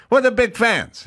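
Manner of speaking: high comic host voice; Jay Leno. Retold or imitated. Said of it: "He goes".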